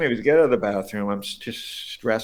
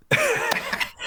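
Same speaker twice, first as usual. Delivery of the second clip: high laugh. Real, not acted. Hearty laugh at the story.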